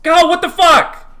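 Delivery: high, loud shocked yell; sudden yelp from a shocked guard; startled security guard. Acted. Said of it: "the security guy goes".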